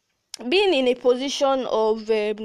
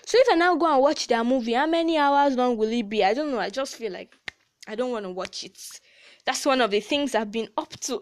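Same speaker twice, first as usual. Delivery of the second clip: normal talking voice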